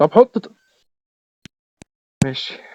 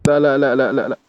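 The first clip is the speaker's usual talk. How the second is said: normal talking voice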